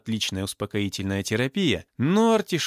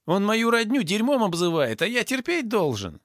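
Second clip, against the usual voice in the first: high, aggrieved outburst; acted indignant protest; 11 semitones higher than usual. A narrator's reading. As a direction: argue high, feeling wronged. Thug argues with guards. (возмущённая обиженная тирада)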